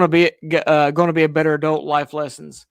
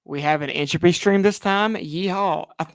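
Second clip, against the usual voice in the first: ordinary talk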